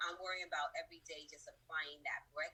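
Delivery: ordinary talk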